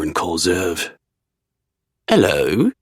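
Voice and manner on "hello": bad British accent. A narrator's reading. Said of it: "very poor imitation of a British accent".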